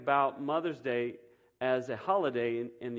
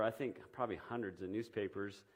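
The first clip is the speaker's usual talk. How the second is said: ordinary talk, nothing marked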